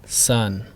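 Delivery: Sun with every sound given equal time; teacher demo: say Sun with even timing; even-length Sun. Shown as a demonstration. Teacher demonstrating Sun, all sounds even. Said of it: "each sound is given essentially the same amount of time".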